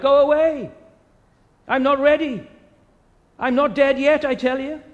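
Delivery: put-on high, loud panicked cry of an old man; terrified shriek. Dying uncle shrieking at an unseen presence, high terrified cry. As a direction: shriek it at something only he can see. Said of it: "His high-pitched, terror-struck voice".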